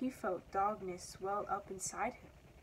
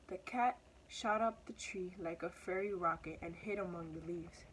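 ordinary delivery

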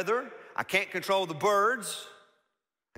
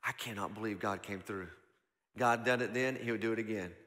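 amazed hush; 9 semitones lower, 7 dB quieter than usual. Retold or imitated. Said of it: "And you'll go".

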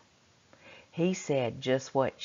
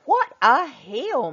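higher, louder and slow; mother marvels at the hill, higher and slow; exclaiming mother; 9 semitones higher, 9 dB louder, slower than usual. A narrator's reading. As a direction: gasp it out at the steep hill. Mother voiced in story.

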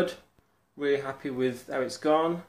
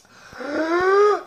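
sharp high gasp of joy; real thrilled gasp; excited gasp; 13 dB louder than usual. Real, not acted. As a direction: gasp loud and high with delight. Excited about eating again.

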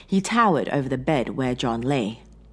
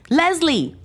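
high, loud cry of the name; shout; 6 dB louder than usual. A narrator's reading. Man calling for Leslie after her scream, shouted. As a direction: call out urgently for a missing woman. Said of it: "he shouted".

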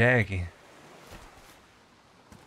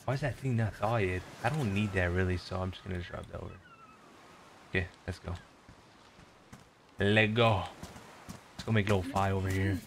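muttering to self; 8 semitones lower, 10 dB quieter, faster than usual. Real, not acted. Mumbling to self while playing.

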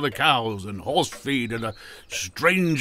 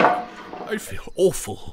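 faint and weak; 4 semitones higher, much slower than usual. Acted. Uncle collapsing, feeling faint.

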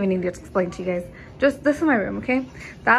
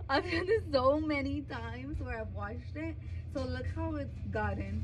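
much softer, half-crying complaint; upset whimper; 3 semitones higher, 13 dB quieter than usual. Real, not acted. Mom shows viewers the washed wallet, softly half-crying. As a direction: near tears over the ruined wallet.

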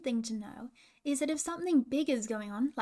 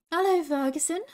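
higher, louder friendly call; 5 semitones higher, 5 dB louder than usual. A narrator's reading. Hooter greets Ferguson jumping on the spot, calling out. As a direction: call out the greeting to someone a little way off. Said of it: "called Hooter".

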